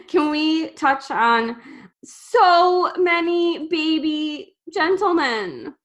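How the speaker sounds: slow, high-pitched cooing; gushing. Real, not acted. Host raising the young bakers with a friend, gushing slowly. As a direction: draw it out adoringly.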